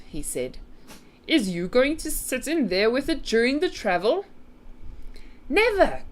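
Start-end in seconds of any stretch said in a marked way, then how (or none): 1.3-4.2 higher, rising storybook giant question; lightly voiced giant, puzzled question; BFG asking. A narrator's reading. BFG asking Sophie about the travel, questioning giant voice. 5.5-6.0 high, loud refusal; indignant cry. A narrator's reading. Sophie refusing the BFG's suggestion, high cry. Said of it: "cried Sophie".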